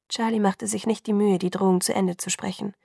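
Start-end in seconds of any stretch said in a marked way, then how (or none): none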